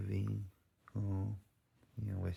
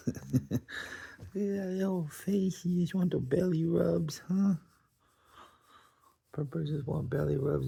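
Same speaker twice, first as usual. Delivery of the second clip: much higher, louder cooing voice; 10 semitones higher, 5 dB louder than usual. Real, not acted. Owner gushing at her dog wanting belly rubs, high baby talk. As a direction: gush over the dog in a high sing-song.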